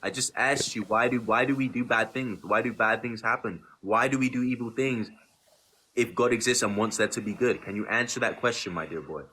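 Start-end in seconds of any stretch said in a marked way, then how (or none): none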